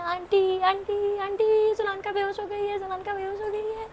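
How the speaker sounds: high, frantic calls of aunty from scared friends; panicked calling out. Retold or imitated. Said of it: "सब मेरे दोस्त लोग चिल्ला रहे हैं".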